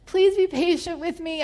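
crying prayer to the Holy Spirit; tearful plea. Retold or imitated. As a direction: beg through tears on "Please". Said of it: "I'm crying and I'm asking Holy Spirit".

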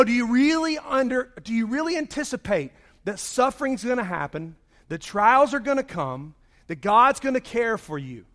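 ordinary talk

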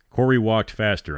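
usual speaking voice

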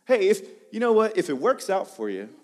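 hammed-up noncommittal suggestion; mock casual excuse. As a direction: play up a breezy get-out clause. Mocks the optional-fasting excuse.

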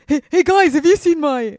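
put-on high and loud American surfer accent; chirpy California surfer dude greeting the guys; Californian surfer dude. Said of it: "American surfer, Californian dude".

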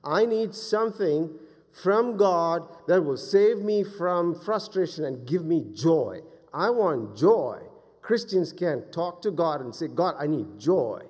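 loud, emphatic plea for joy; impassioned preaching. Real, not acted. Preacher crying out his need for joy, impassioned preaching. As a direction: lean hard on the key words.

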